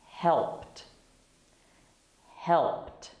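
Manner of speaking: "Helped" as one syllable ending in a T. Shown as a demonstration. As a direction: say HELPT, crisp T at the end. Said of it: "The E D sounds like a T".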